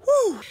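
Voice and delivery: high loud woo; excited whoop. Real, not acted. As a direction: let out a whoop. Whoop on return.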